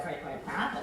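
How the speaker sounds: under her breath. Retold or imitated. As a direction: mutter it where he can't hear. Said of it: "say something nasty under my breath".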